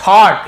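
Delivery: "थॉट" as one syllable with an open o; model pronunciation. Shown as a demonstration. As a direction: say "थॉट" clearly for learners, gh unsounded. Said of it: "इसे थॉट पढ़ेंगे". ("थॉट" का साफ़ उच्चारण)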